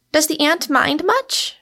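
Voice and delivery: high, innocent question lifting at the end. A narrator's reading. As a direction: ask it lightly and high, all innocence. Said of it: "asked Rosamond innocently".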